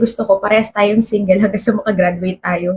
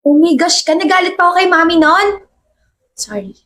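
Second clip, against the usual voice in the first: usual speaking voice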